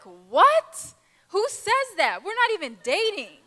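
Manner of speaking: loud, high what, then rising disbelief; shocked disbelief. Retold or imitated. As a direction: recoil at the idea: who says that. Shocked by the love letter.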